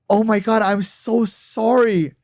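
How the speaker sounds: very high, loud, slow mimicked gush; mock her gushing apology, way up high. Imitating the girlfriend apologizing to him, high gushing voice.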